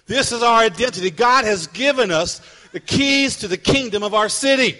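loud, rising sermon delivery; preaching. Acted. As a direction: preach it out to the congregation. Preaching at full force.

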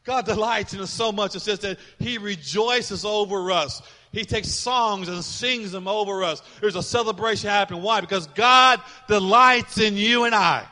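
loud, slow, soaring preacher cadence; preaching at full force. Acted. Pastor to congregation on God's delight, full-force preaching. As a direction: preach it to the rafters, drawn out.

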